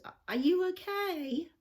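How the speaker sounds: high, lightly mocked American accent; a friendly American driver asking if she's okay. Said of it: "in his American accent".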